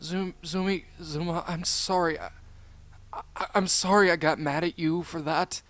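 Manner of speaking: upset apology. Acted. Character tearfully apologises to friend.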